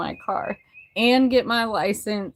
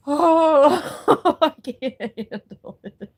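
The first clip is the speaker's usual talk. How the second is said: laughing. Real, not acted. Overwhelmed laughing at milestone.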